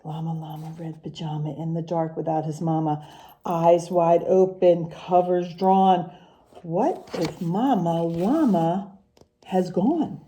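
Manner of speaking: sing-song rhyming picture-book read, unhurried; reading to children. Acted. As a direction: read the rhymes to the class with a lilt. Teacher reads picture book aloud.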